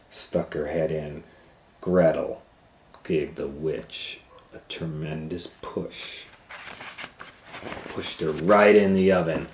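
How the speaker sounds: ordinary delivery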